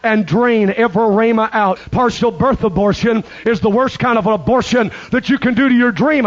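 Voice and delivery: ordinary talk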